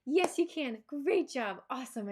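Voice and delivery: high cheerful coaxing, swallowing anger; forced happy call. Shown as a demonstration. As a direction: chirp encouragement over your frustration. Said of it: "swallow all my anger and I have to be like".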